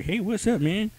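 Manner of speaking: high, laid-back dude greeting; casual bro voice. Retold or imitated. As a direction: play it like a carefree buddy. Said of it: "are just like".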